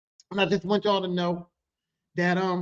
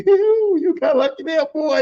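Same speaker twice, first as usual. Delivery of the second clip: higher, louder relieved whoop; relieved teasing laugh; 9 semitones higher, 7 dB louder than usual. Retold or imitated. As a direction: tease a coworker who just got lucky. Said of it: "It's a laughter like".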